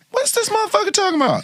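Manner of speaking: mock a baffled Kamala; high rapid disbelief; Kamala impression. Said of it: "She was like".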